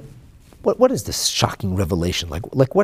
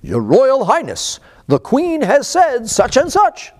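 high, loud, slow pompous announcement; formal court interpreter; 8 dB louder, slower than usual. Retold or imitated. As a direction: announce it like a stiff royal herald. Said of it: "the interpreter will say".

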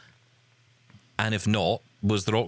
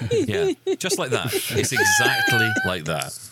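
laughing; slower than usual. Real, not acted. Laughing at Scottish impression.

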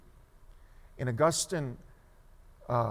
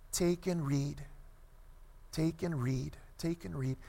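soft, lightly chanted refrain; hushed imitation of a child's chant; child's distant singsong; 8 dB quieter than usual. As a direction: repeat it gently, like a child heard from afar. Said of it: "voice of this small child singing over".

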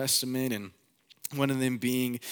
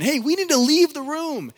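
high, loud alarmed cry; panicked shout; 11 dB louder than usual. Retold or imitated. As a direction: yell to clear the room in panic. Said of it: "everyone's freaking out".

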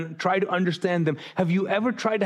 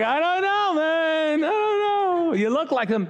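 high, doubtful mock voice, much higher than his own; mock suspicious voice; 10 semitones higher, slower than usual. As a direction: play a wary stranger squinting at him. Mocking people suspicious of him.

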